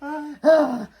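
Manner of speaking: high loud mock yelp of dread; startled squeal; playful horrified ah; dismayed gasp. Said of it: "And I'm like".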